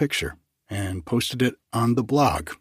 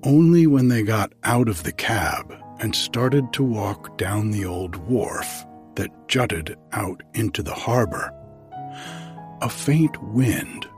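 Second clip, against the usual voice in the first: story narration. Acted. Begins reading the story.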